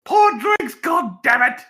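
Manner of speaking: shouted attempt at Australian accent. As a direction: bark the order in a weak Australian accent. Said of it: "I can't really do an Australian accent".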